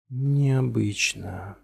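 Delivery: slow, stretched, distant echo; time-smeared echoing voice. A narrator's reading. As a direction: let the word drift back like an echo. Word echoes back in a haze.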